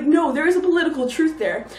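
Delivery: ordinary delivery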